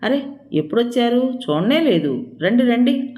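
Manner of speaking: light up at the guest's arrival; delighted surprise. A narrator's reading. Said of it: "సంభ్రమంగా ఆనందంగా".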